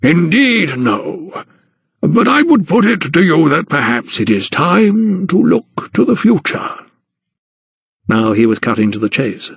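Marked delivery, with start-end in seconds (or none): 0.0-6.8 a hint of an old gentleman, higher and slow in places; lightly voiced patronising guardian; Sir Montague, lightly voiced. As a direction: narrator lightly playing a condescending old aristocrat.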